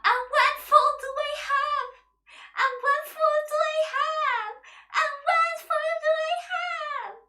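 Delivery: high, loud, sing-song wailing lament; wailing yell. Retold or imitated. Woman on the phone yelling and crying at someone, high loud wail. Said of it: "yelling and crying".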